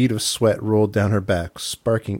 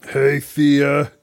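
a lightly different woman voice, a bit higher; new character voice; 5 semitones higher, slower than usual. Acted. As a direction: give Fiona her own voice. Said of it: "Oh, I like this character".

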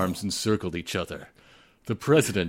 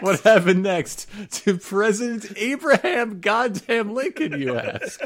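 mock dramatic announcer; 12 semitones higher, 4 dB louder than usual. Mock trailer narrator.

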